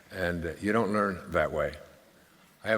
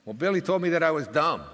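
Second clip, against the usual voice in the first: put-on higher, louder little-kid complaint; hurt child; 10 semitones higher, 4 dB louder than usual. Small daughter telling dad what Billy said, higher hurt childlike. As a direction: tell on Billy like a wounded kid. Daughter reporting a schoolmate insult.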